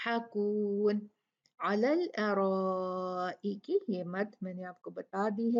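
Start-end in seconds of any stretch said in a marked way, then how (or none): 0.1-3.8 very slow melodic recitation; measured Quranic chant. Shown as a demonstration. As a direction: stretch the vowels in tajweed chant.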